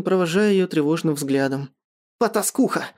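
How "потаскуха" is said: higher-pitched, mild snarl; snarled insult. A narrator's reading. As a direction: spit the word out angrily. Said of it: "прорычал Стефан".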